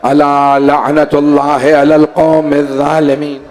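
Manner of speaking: slow, low, measured recital; Arabic recitation. Acted. Preacher closing the sermon with an Arabic verse, slow low recital. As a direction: intone the verse slowly and evenly.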